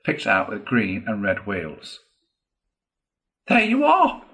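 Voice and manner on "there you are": high, loud exclamation; triumphant cry. A narrator's reading. Toad showing off his new caravan, crying out. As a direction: cry it out as a proud reveal. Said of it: "cried the Toad".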